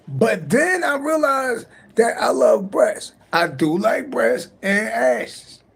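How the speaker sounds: high, slow gushing shout; excited. Real, not acted. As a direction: savour every word with glee. Gushing about breasts, heard shout.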